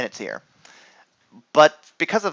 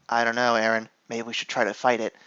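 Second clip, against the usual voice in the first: soft, lower mumble; mock Nicole; 5 semitones lower, 8 dB quieter, faster than usual. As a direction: answer meekly, much softer. Nicole voiced, softer.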